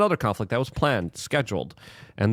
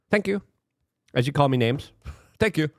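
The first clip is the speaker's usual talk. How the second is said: sarcastic thanks; 8 semitones higher than usual. Real, not acted.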